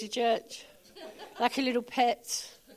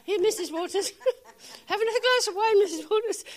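higher, laughing mock-polite server voice; mock flight attendant; 9 semitones higher than usual. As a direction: imitate the sweet hostess offering wine, laughing. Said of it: "you know, like".

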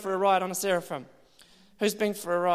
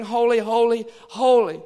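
louder ringing holy; proclaiming cry; 6 dB louder than usual. Retold or imitated. Creatures proclaiming around the throne, louder cry. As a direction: call it out like the creatures.

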